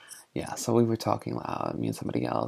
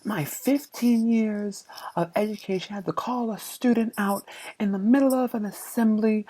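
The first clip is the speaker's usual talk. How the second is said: stuffy principal; 12 semitones higher, 5 dB louder than usual. Retold or imitated. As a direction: play the outraged principal, lifting the pitch high. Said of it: "the principal's like".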